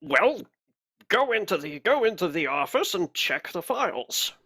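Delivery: impatient official, lightly voiced; arbitrator impression. Fake arbitrator sending the guard to check files, impatient impression. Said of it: "do a good enough impression".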